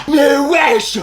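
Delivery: high, loud, stretched outburst; loud indignant protest; outraged shout. Acted. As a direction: shout in disbelief. Outraged reaction to intercepting note.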